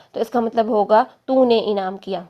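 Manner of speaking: ordinary talk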